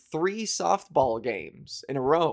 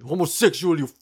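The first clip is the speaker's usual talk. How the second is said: loud, higher yell of a stranger; angry harasser; 4 semitones higher, 9 dB louder than usual. Retold or imitated. Stranger on the streetcar yelling at her, loud and angry. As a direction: shout it like a hostile stranger. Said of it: "one more time".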